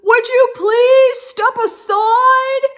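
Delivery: high, loud shouted request; yelled plea. Acted. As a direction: call out to the moose in alarm. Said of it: "So he yelled to the moose".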